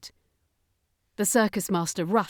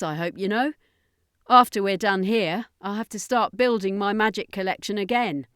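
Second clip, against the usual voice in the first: confident alien; 4 semitones higher, 4 dB louder than usual. Acted. Danica voiced, confident alien.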